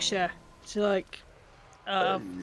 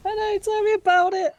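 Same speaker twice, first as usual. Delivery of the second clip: high, mock-exasperated Yorkshire sigh; mock exasperation; 11 semitones higher, 3 dB louder than usual. Real, not acted. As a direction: play up the woe. Mocking complaint about accent.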